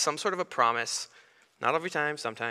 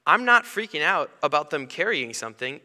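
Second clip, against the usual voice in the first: usual speaking voice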